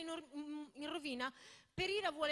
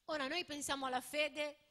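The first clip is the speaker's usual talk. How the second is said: normal talking voice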